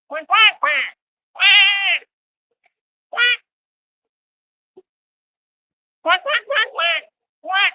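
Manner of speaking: very high squeaky quacking; duck voice. Pretend. Clowning for the camera as a quacking duck.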